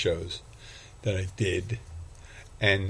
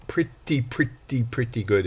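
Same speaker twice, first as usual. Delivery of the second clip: drawn-out higher first words, then settling; borrowed catchphrase; 3 semitones higher than usual. Retold or imitated. Podcaster stealing a catchphrase to rate his shift, drawn-out catchphrase. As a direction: stretch out each repeat, stepping down in pitch. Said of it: "if I could steal that from that".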